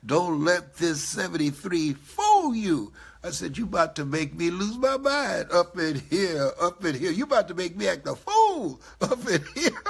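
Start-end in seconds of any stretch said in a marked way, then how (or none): 0.1-2.9 slow, louder mock threat; mock tough warning. Warning that his age shouldn't fool anyone, hammed-up tough. 3.6-9.8 louder playful fed-up rant; hammed-up exasperation, breaking into a laugh; mock exasperated outburst. Answering his mentee, playfully exasperated and loud. Retold reply to mentor, joking.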